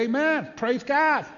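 high, bright call of praise; joyful amen. Shown as a demonstration. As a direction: lift it up like a shout of praise. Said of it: "everyone should say".

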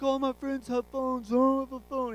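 high-pitched mock teen complaint; imitated whining teenager. Dad mimics his son begging for a first iPhone, mock whine. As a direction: put on a pleading kid's whine. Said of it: "He was doing that whole thing".

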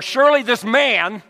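"surely this man" loud and high, surely stressed; stress demo. Shown as a demonstration. Preacher testing another emphasis, loud. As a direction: hit "surely" hard. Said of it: "the emphasis was on".

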